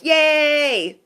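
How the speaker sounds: high, gleeful mock shout; mock cheer. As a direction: cheer like a gloater, hammed-up. Acts out people gloating.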